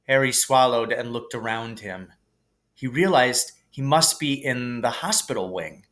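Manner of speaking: usual speaking voice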